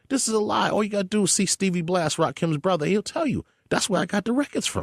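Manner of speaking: upset. Real, not acted. Upset about Marley, heated.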